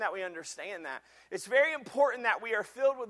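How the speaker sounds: loud, high, driving preacher delivery; full-force preaching. Acted. Pastor preaching to the congregation, loud and driving. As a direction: preach it hard to the whole congregation.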